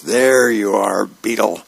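sergeant's half-yell, building toward the end; lightly acted yelling at a subordinate; mild yell. A narrator's reading. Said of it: "he's yelling at Beetle".

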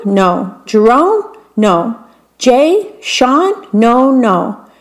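usual speaking voice

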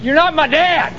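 heated denial. Acted. Son rejecting his estranged father, heated. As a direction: reject him hotly. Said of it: "You got a little heated".

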